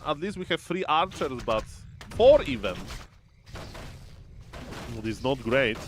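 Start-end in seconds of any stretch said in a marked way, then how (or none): 2.2-2.8 higher, louder sudden burst; surprised. Real, not acted. Caster realising there are four archers, surprised. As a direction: blurt it in surprise.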